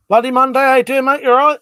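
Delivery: big, loud, high call to a mate; loud, happy hello; loud greeting. Real, not acted. Greets a viewer in chat.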